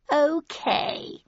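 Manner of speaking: high, grand and drawn-out; grand announcement. Acted. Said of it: "he announced grandly".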